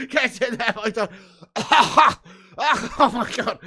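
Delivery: high, loud laughing cheer; can't stop laughing while celebrating; real helpless laughter. Real, not acted. Streamer celebrating the missed penalty with chat, laughing.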